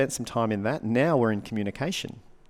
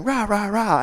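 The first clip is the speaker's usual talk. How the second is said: higher, louder nonsense rant; mock ranting wife; 12 semitones higher, 8 dB louder, slower than usual. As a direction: parody her shouting. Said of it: "she's going".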